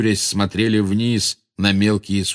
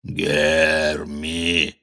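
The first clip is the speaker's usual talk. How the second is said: low, long-drawn giant growl; deep, lumbering monster voice; giant's growl; 5 semitones lower than usual. A narrator's reading. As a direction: growl it slowly like a huge creature. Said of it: "прорычал Грох".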